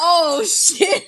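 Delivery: mock-hurt villain reacting to a sledgehammer in the gut; hurt Freddy. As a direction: play the winded, stunned monster. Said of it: "And he's like".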